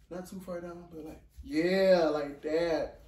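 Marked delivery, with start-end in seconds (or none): none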